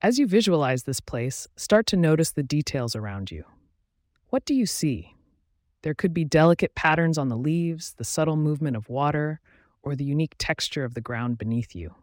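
measured guided-visualization delivery with long pauses; guided meditation. Acted. Host guiding listeners into a relaxing visualization, calm meditation voice. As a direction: guide the listener gently through each image, pausing between steps.

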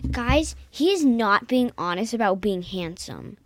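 loud teasing shout. Real, not acted. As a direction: yell it at the audience, teasing. Kid loudly denies dad handsome.

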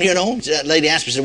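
ordinary talk, nothing marked

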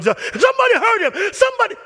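high, loud panicked cries; frantic mom. Retold or imitated. Mom on the field crying to the referee, frantic. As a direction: wail like a frantic mother.

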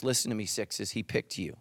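low, intimate encouragement; reassuring. Real, not acted. Preacher telling frightened sixes they were picked, reassuringly. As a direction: drop low and speak earnestly to them.

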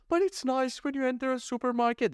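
high mock-sweet voice of the naive shopper; squeaky mimicry of a know-it-all shopper. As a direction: parody the people who love supermarkets. Mocking what everyone says.

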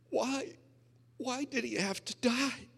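put-on high, tearful quote of the girlfriend; imitating crying wife. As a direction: voice the weeping girlfriend's question. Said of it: "And she's crying".

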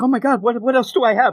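high, flustered and rushed; flustered panic. Retold or imitated. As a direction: panic about what's left in the bag. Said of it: "he's like".

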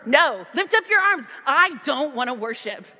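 put-on stubborn teen shouting no; defiant outburst. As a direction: throw the words back in protest. Defiant refusal to God.

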